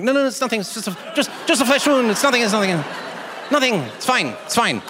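high, frantic mock denial; comic insistence that all is fine.

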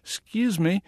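Southern accent, playing the innocent visitor. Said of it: "in my best Southern accent".

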